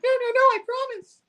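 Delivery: high, panicky squeal of pleading; mock frightened plea. Retelling Betty begging the thug leader, mock panicked pleading.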